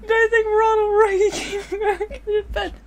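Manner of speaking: high loud laughing question. Real, not acted. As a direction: squeal it through laughter.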